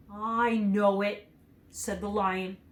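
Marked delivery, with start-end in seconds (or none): 0.1-1.3 slow, ashamed admission. A narrator's reading. The lion admits his cowardice to Dorothy, ashamed. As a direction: hang your head as you say it. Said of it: "hanging his head in shame".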